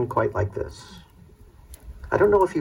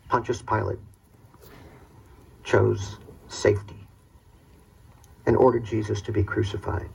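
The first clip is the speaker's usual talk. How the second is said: ordinary delivery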